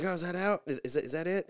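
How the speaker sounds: a puzzled worker checking the order; a touch of a puzzled drive-thru worker. Retold or imitated. Drive-thru worker confused over the intercom, mock-high. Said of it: "she's like".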